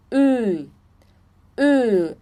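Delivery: a rounded ö sound; rounded German ö. Shown as a demonstration. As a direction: pout the lips and say Ü. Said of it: "um biquinho e falar E".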